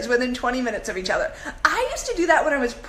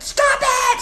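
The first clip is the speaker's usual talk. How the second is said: high, loud stop the car; excited shout; 11 semitones higher, 6 dB louder, slower than usual. Retold or imitated. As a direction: yell it like the car must stop now. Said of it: "and I was like".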